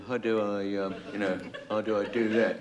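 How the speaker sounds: a hint of a dithering new producer; producer impression. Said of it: "a very lifelike impersonation of our new producer".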